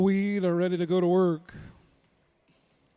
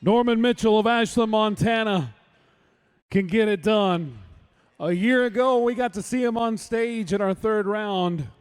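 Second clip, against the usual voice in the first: louder, higher arena announcing; booming rodeo announcer read; announcer intro; 4 semitones higher, 6 dB louder than usual. Real, not acted. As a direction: hype the roper to the arena. Announcer introducing the next roper.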